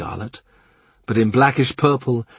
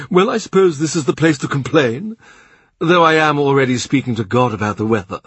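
higher, louder indulgent host; the Cardinal's offer; 5 semitones higher, 4 dB louder than usual. A narrator's reading. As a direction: ask like a generous prince. Cardinal's wry reply.

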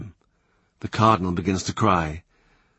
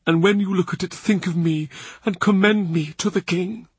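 high-pitched, earnest plea; imploring old churchman; begging; 8 semitones higher than usual. A narrator's reading. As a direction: plead to be remembered, voice lifted. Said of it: "the Cardinal begs him".

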